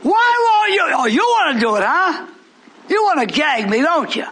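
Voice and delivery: high, loud mocking challenge; defiant shouted taunt. Real, not acted. Preacher daring the judge to gag him, shouting. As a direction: fling the taunt at them full voice.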